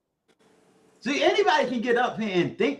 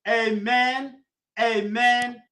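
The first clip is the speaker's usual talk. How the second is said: solemn benediction; 2 semitones higher than usual. Acted. Uncle blessing Maggie's viewers, solemn benediction. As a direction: send them off with God's blessing.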